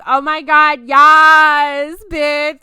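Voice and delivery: mock triumphant cheer; gleeful yes. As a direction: celebrate like a sassy friend. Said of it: "And I was like".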